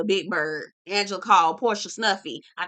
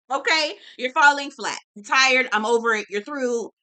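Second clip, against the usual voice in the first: normal talking voice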